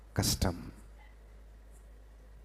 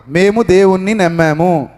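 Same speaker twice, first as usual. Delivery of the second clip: firm, louder declaration; 7 semitones higher, 4 dB louder than usual. Shown as a demonstration. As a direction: proclaim it together. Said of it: "గట్టిగా చెప్పాలమ్మా".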